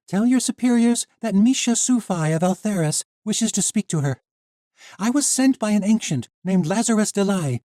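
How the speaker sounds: put-on high, loud young heroine; defiant young woman. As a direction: stand up to the guard. Misha demands an audience.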